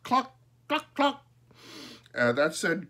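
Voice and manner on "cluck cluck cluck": high bird imitation of tongue clicks; sharp high clucking; crow's clucks. A narrator's reading. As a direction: cluck high like a mimicking crow. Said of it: "Father heard cluck".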